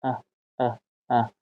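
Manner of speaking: short, unstressed "اه", each one separate; schwa sound. Shown as a demonstration. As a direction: drop "اه" out softly as a tiny neutral "uh".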